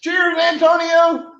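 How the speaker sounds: shouted cheers, slow and high. Real, not acted. Raising a beer toast.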